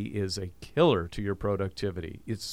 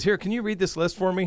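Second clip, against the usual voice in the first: put-on girl in commercial; 10 semitones higher, 4 dB louder than usual. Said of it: "and says".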